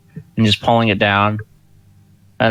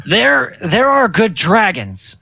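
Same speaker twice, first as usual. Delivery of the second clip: slow, high-pitched insistence; speaking up; 11 semitones higher, slower than usual. Acted. Driscoll correcting the doubter about dragons, slow and high. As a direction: pipe up hesitantly, drawing the words out. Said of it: "Driscoll kinda speaks up".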